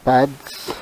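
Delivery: ordinary talk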